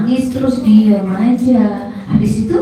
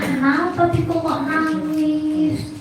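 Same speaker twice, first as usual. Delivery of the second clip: higher, softer, slow drawn-out question; questioning teacher; 5 semitones higher, 5 dB quieter, slower than usual. Acted. Teacher asking a crying child why, soft slow question. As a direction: lightly play a teacher asking, gently slowed. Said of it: "terus ustadzah tanya".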